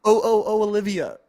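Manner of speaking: loud and high, greeting the chat; calling a viewer's name. Real, not acted. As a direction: call her name out loud.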